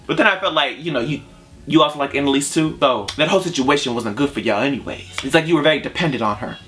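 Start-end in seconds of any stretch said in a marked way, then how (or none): none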